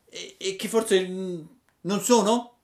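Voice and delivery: put-on high, stammering puppet boy; hesitant Pinocchio. (Pinocchio esitante, voce alta)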